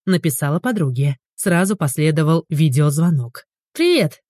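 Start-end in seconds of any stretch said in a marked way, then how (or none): 3.7-4.2 bright high greeting; cheery hello. A narrator's reading. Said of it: "Оля помахала ей рукой".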